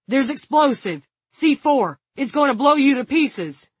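loud, high, urgent shouting; urgent shouted warning. A narrator's reading. Frank warning the guard about the explosive, urgent shout. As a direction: shout to make him believe the danger.

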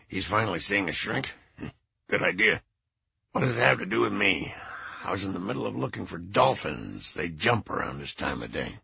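put-on low, stuffed-up old man; low congested voice. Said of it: "a low, congested voice said".

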